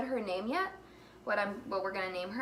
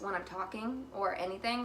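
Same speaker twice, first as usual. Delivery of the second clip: ordinary delivery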